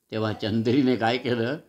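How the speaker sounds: ordinary delivery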